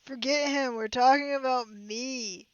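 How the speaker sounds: a self-absorbed monster crowing; hammed-up monster. Villain monster demands attention.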